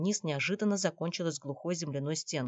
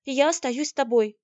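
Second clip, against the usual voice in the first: high, loud refusal; protesting; 9 semitones higher, 9 dB louder than usual. A narrator's reading. Наташа refuses to leave her beloved, high loud protest. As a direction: dig in and refuse. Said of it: "воспротивилась Наташа".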